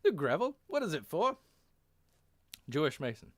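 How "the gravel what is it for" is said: put-on high-pitched German accent; German-accented officer's question. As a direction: ask sharply as a German captain. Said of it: "Captain York in German".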